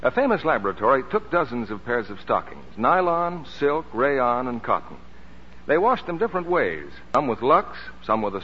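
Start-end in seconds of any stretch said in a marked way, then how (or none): none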